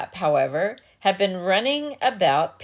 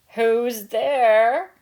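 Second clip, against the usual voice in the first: put-on higher, louder old grandmother calling out; 4 semitones higher, 4 dB louder than usual. A narrator's reading. Grandmother calling to the knock at her door. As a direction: call toward the door from bed.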